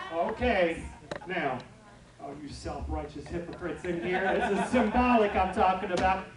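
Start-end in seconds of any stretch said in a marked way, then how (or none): none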